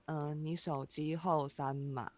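slow, soft monotone with no rise or fall; bored clerk impression. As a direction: drag it out like a clerk who doesn't want to be there. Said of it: "他声音一直都是这样，然后而且都没有起伏，然后超级慢".

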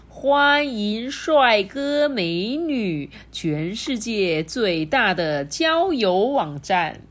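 slow sign reading. Acted. Reads spider's sign aloud.

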